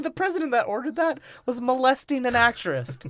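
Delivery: high, goofy defensive voice; mock excuse. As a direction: squeak it out as a lame excuse. Voicing character, high.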